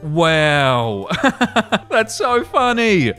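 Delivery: giggling delight at a funny ending; laughing. Real, not acted. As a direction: laugh through it, stretching the words. Laughing reaction to story.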